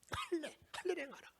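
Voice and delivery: hushed echo of the command; soft command. Real, not acted. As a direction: murmur the order again. Repeated command softly.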